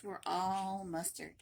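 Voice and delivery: usual speaking voice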